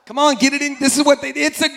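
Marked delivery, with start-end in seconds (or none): none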